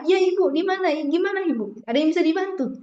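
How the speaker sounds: high, eager, helpful answer; matched lively reply. Shown as a demonstration. Student answering the lecturer with matched high energy. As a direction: meet her energy, bright and high. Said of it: "akan sefrekuensi".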